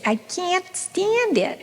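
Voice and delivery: a light put-on whine of protest; imitating her complaint. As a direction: sigh it out as the fed-up star. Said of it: "I think she says".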